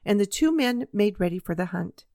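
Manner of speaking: plain reading voice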